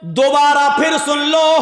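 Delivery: high, chanted calling-out on held notes; full-force preaching. Real, not acted. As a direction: summon distant crowds, stretching phrase endings. (बुलंद आवाज़ में पुकारती तक़रीर)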